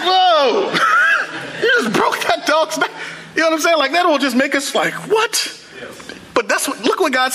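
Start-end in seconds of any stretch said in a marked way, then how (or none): 0.1-2.9 much higher, a laughing shouted whoa; mock shocked shout. Imagined onlooker sees dog killed. 5.1-5.6 much higher, a sharp disbelieving question; incredulous what. Retold or imitated. Congregation reacts in disbelief.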